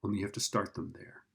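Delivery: ordinary delivery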